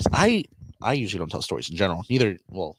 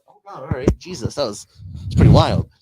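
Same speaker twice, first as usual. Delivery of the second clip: ordinary talk, nothing marked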